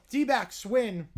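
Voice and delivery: usual speaking voice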